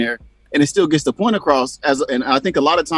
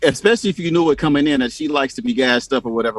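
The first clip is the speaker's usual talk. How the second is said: ordinary talk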